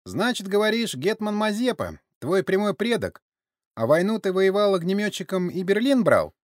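high-pitched sceptical questioning; veiled mockery. A narrator's reading. Sergei questions the old man's war stories, veiled mockery. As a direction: repeat their claims back tauntingly. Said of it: "Со скрытой насмешкой".

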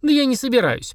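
put-on high, indignant little girl; girlish refusal, high-pitched; child's voice. A narrator's reading. Girl clutching the book, high child voice. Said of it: "прижала Криобал к груди Полина".

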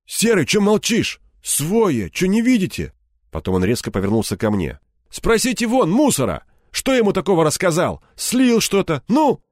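high-pitched, heated challenge; agitated demand. A narrator's reading. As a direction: press them for an answer, pitch jumping up. Пленник требует подтверждения у сообщников. (взвинченно, требовательно, высоким голосом)

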